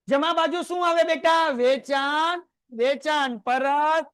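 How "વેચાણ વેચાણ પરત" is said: stretched out, said slowly for the class; teacher drawing out the answer slowly; slow recital. Shown as a demonstration. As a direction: drag the words so students can join. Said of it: "બધાય મારી સાથે બોલો".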